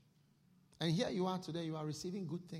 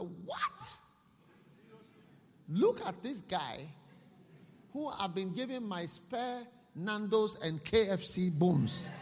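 high, loud indignant rich man in hell; rich man's outrage; 4 semitones higher, 4 dB louder than usual. Acted. Preacher voicing the rich man in hell, outraged and loud. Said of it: "and said".

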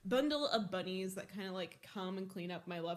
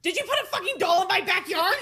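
much louder, higher frantic demand; Dumbledore rampage; 9 semitones higher, 14 dB louder, faster than usual. Retold or imitated. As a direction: yell the accusation at mom. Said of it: "fucking full Dumbledore mode".

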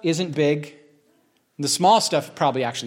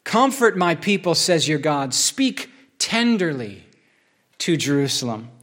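slow, solemn reading of scripture; 3 semitones higher, slower than usual. Acted. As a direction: lift the words up slowly, as if proclaiming comfort. Preacher reads Isaiah 40 aloud.

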